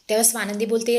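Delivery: ordinary delivery, nothing marked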